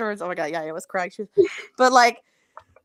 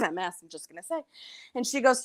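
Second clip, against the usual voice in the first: ordinary talk, nothing marked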